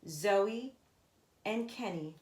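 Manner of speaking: ordinary delivery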